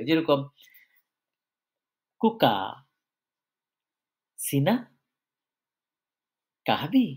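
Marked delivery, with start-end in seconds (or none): 2.2-7.1 "কুকা Cena কাহাবি" one by one, lifting at word ends; rising question tune. Shown as a demonstration. Teacher showing question words rise, rising question tune on "কুকা Cena কাহাবি". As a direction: ask "কুকা", "Cena", "কাহাবি" as separate questions, long pauses between. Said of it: "উত্থান হবে".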